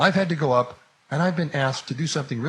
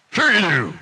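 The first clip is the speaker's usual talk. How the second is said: loud, high-pitched insistence; insistent; 6 semitones higher, 7 dB louder than usual. Acted. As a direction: push back loudly in disbelief. Old man insists on the problem.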